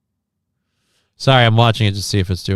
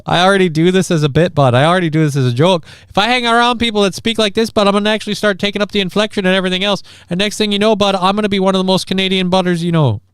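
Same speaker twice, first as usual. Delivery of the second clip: a hint of mock Canadian, fast and higher; lightly put-on Canadian accent, racing; Canadian accent bit; 6 semitones higher, faster than usual. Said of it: "my accent is gonna become Canadian".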